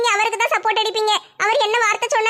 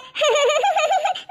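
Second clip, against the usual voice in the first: bitter laugh; 6 semitones higher than usual. Acted. Wife laughs in distress.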